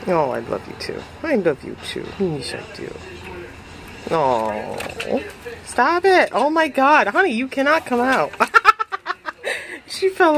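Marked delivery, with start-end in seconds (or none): 0.1-4.8 low, soft loving murmur. Real, not acted. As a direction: coo to the pet. Tender talk to cat.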